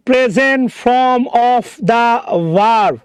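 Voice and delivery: very slow, word by word; stretched, deliberate teacher's repeat; slow emphatic repeat. Shown as a demonstration. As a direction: spell out each word slowly for the class. Repeats it slowly for careful attention.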